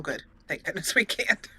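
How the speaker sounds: ordinary talk, nothing marked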